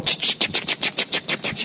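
breathy ch ch ch chopping sounds; chopping noises. Retold or imitated. Chopping the marijuana plant like a TV chef, breathy chops. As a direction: imitate a knife chopping fast. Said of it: "So I'm going".

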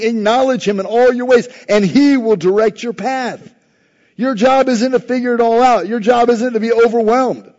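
high, loud, impassioned sermon delivery; full-force preaching. Acted. Preacher rallying the congregation, loud impassioned preaching. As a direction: preach it out to the back row.

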